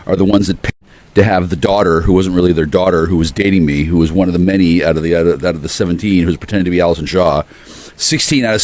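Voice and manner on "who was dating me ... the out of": ordinary delivery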